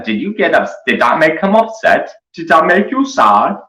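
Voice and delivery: very high, sing-song fake pity; baby-talk mockery. Mocking the toolbox man in fake pitying baby talk. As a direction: coo at him like a hurt toddler.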